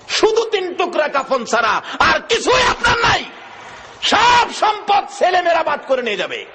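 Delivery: high, shouted sermon warning; full-force preaching. Real, not acted. Preacher warns listeners they leave with only a shroud, full-force preaching. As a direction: drive the warning home at a high pitch.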